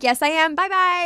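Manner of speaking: high, bright sing-song goodbye; 1 gleeful brush-off. Retold or imitated. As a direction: toss it off cheerfully, pitch rising.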